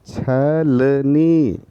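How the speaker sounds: put-on teacher modelling छलनी slowly for children; धीमा, साफ़ उच्चारण; slow demonstration. Shown as a demonstration. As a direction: say छलनी very slowly, each syllable clear. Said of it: "उच्चारण करके बोलिए".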